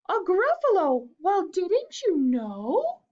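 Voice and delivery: high, loud, slow mouse spinning a scary tale; mouse spooking fox. Acted. Mouse scares the fox, high and dramatic. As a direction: make the monster sound dreadful.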